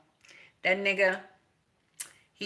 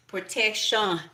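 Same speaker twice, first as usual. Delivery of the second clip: ordinary delivery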